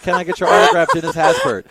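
high, eager fan voices, climbing; excited fans. Retold or imitated. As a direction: mimic fans begging for an autograph. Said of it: "they were like".

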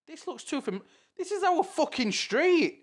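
high-pitched disbelief building to an outburst; dawning realisation. Retold or imitated. Retells recognising their own street.

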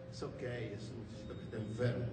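low, very soft quoted reply; hushed aside. Retold or imitated. Pastor excusing the boy to the visiting preacher, hushed. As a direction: drop right down as if confiding.

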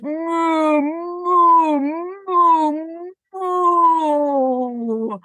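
storyteller mooing like cows; chorus of moos. Acted. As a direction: moo like a whole herd. Many cows call out.

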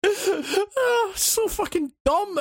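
high laughing words, slowed. Real, not acted. Laughing at the dumb game.

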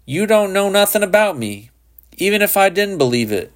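high-pitched defiant protest; high, heated boyish defiance; defiant retort. A narrator's reading. Boy argues back heatedly.

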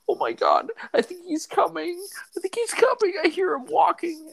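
very high squealed mock terror; pretend tearful panic. Prank caller warning the lady he's coming, mock panic. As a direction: imitate a kid faking frightened sobs. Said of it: "pretending to cry".

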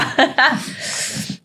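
soft, airless chuckle; quiet wheezing laugh; breathy laugh. Real, not acted. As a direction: laugh silently under the breath. Laughs after retold anecdote.

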